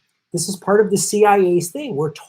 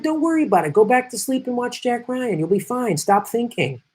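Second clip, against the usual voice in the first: mock reassurance; 6 semitones higher than usual. Mocking soothing establishment voice.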